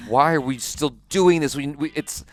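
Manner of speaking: a touch of restless hurry-it-up; impatient. Retold or imitated. Viewer urging the sitcom to move on, mildly impatient. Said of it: "I'm just a little bit impatient".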